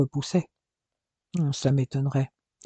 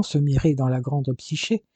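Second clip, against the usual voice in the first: ordinary delivery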